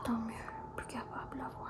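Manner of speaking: hushed whisper. Real, not acted. Whispered replies.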